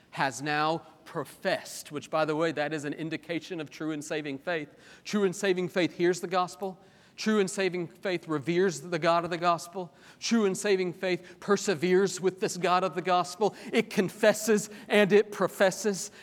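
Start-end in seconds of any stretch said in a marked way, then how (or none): 5.0-16.1 preached repetition, climbing louder; building litany. Acted. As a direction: stack each line on the last.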